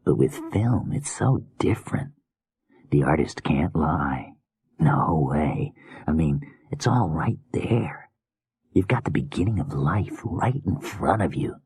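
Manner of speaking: soft creepy murmuring killer; hushed, unsettling madman musing; menacing whispery killer. Acted. Creepy killer muses on art.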